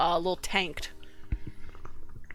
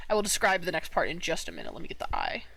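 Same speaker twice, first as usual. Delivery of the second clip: ordinary delivery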